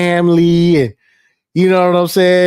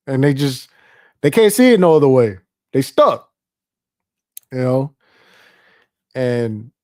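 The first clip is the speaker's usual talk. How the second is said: usual speaking voice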